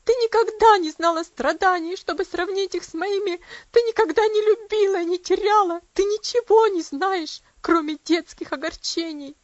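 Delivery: loud, high, reproachful outcry; passionate bitter outburst. A narrator's reading. Aunt accusing the girl of never suffering, loud and bitter. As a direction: throw it at her with bitter passion. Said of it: "страстно воскликнула девушка".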